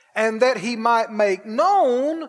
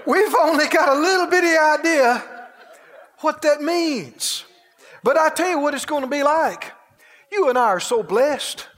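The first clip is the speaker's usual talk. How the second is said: ordinary talk, nothing marked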